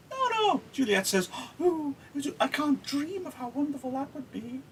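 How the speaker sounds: high, sweet mock-obedient voice; sugary obedient girl. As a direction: gush like a too-perfect daughter. Mock dutiful Juliet voice.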